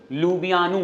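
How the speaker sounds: लुबियानू said as lu-bi-ya-nu, the local way; local pronunciation. Shown as a demonstration. Teacher showing students the local pronunciation, लुबियानू as lu-bi-ya-nu. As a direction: give the local sound of लुबियानू, lu-bi-ya-nu. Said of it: "pronunciation है लुबियानू".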